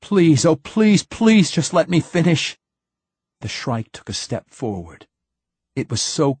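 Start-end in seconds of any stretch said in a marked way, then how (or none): 0.0-2.6 high, loud desperate pleading; begging. A narrator's reading. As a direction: beg for his life's work. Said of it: "He begged now".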